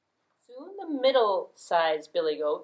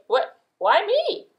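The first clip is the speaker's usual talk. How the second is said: high, loud, slow why me; startled protest; 12 semitones higher, 7 dB louder, slower than usual. Acted. Little goat picked to go first, high startled goat. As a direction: squeak out a dismayed why me.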